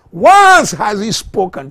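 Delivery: high, loud preacher's cry; ringing pulpit shout; shouted proclamation. Real, not acted. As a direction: shout it out to the church. Sermon climax.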